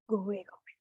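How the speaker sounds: soothing release, trailing off; fading go away. Shown as a demonstration. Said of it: "leads release in breathing".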